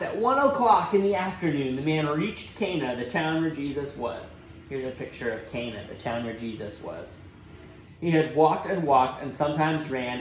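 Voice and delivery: plain reading voice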